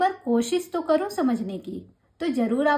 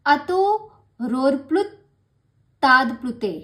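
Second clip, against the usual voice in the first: sutra recitation; 5 dB louder than usual. Shown as a demonstration. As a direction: intone it like a chant. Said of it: "reciting the sutra".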